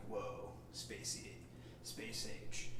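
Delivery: slow, breathy whispered awe; spacey whisper. Pretend. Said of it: "a little bit like".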